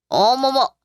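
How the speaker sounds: a theatrical, put-on gasp of surprise; exaggerated "oh my" from an offended older woman; mock shock. Mother-in-law interrupts with mock shock.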